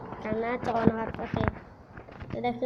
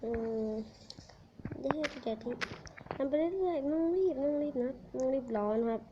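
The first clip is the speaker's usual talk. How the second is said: keeping it quiet; 6 dB quieter than usual. Real, not acted. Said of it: "จะเงียบๆหน่อยนะครับ".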